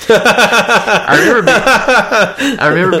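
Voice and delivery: laughing through the words; talking while cracking up. Real, not acted. Laughs remembering a childhood movie.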